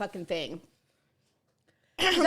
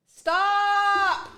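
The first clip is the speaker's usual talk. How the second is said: loud cry of burning pain; pained shout; 9 semitones higher, 7 dB louder than usual. Real, not acted. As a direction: yell it out, mouth on fire. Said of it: "lit a match in my mouth".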